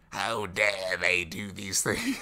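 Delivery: slow, loud villain rant; raspy old father. Retold or imitated. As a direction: rage like a Palpatine-ish old man. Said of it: "not a bad impression".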